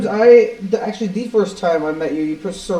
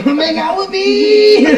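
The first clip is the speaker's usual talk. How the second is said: mock drunk invite; 12 semitones higher, 4 dB louder, slower than usual. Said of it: "quoting Cody's voicemail".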